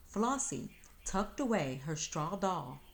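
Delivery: plain reading voice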